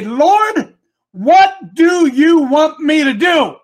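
loud, high, drawn-out plea; calling to God. Acted. Prophet on the tower asks the Lord, calling out loudly. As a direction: cry it up to heaven, word by word.

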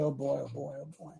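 normal talking voice

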